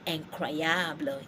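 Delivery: Incroyable opening on a nasal "an", said slowly; French pronunciation. Shown as a demonstration. Teacher giving an example of I N, French pronunciation. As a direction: Incroyable slowly as "an-krwa-yabl". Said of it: "to say I N in French".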